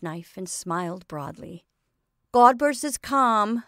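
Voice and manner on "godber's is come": announcing. A narrator's reading. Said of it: "announced Sadie".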